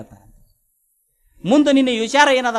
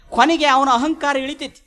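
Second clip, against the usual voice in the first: ordinary delivery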